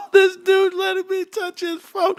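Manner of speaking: very high squealing mock excitement; excited. Kids thrilled to be handed dad's phone, hammed-up excited squeal. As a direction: squeal it with a laugh, hammed-up glee. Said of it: "do you know how excited they get".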